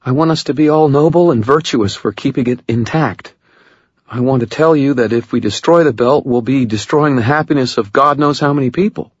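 high, fast, pained woman's voice; lightly voiced Murphy, rushing on; Murphy, emotional. A narrator's reading.

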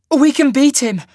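very high, loud plea; desperate pleading. A narrator's reading. Sasha begging Cole to keep fighting, pleading. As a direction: plead urgently at full pitch. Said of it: "Sasha pleaded".

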